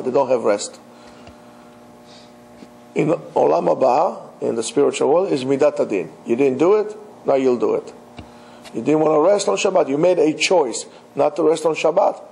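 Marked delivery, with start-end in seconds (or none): none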